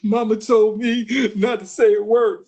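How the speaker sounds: put-on gasping, hitched kid's chant; mock post-spanking hiccups between phrases; spanked child. Kid answers what happened after a spanking, mock hitching sobs. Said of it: "when you used to get a spanking".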